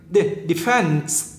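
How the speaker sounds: "defense" slow, stress on the second syllable; nhấn âm hai. Shown as a demonstration. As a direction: isolate "De-", then lean on "fense". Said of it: "nhấn năm hai". ("defense" đọc chậm, nhấn âm hai)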